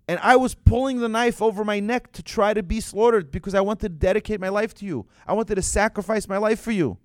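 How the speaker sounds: loud, high, impassioned protest; fervent plea. Retold or imitated. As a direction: pour out your sacrifice at full force. Isaac pleading his case to God.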